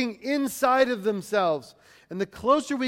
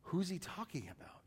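hushed, dumbfounded question; quiet bewilderment in a low-key acted question; 3 semitones lower, 16 dB quieter than usual. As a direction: ask it very softly, at a loss.

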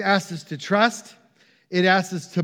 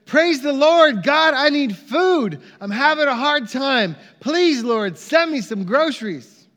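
high, raised cry to heaven; shouted plea; 7 semitones higher, 4 dB louder than usual. Retold or imitated. Elderly lady praying on her porch for food, shouted plea. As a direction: start loud and ease into earnest begging. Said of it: "and she shouted".

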